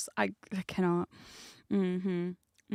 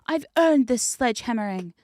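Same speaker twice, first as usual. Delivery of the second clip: louder, higher hammed-up protest; mock defiance; 4 semitones higher, 7 dB louder than usual. Host refusing onlookers, sledgehammer in hand, loud mock defiance. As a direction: insist loudly, like a hammed-up villain refusing to stop. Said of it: "And I'm like".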